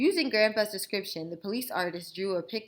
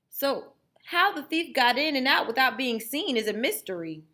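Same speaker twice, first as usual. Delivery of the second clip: officer; 7 semitones higher, 8 dB louder than usual. Acted. Police officer reporting.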